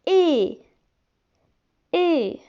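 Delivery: é said as a clear closed ay vowel; vowel demo; é sound. Shown as a demonstration. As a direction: model é for the pupils. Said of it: "E I se prononce".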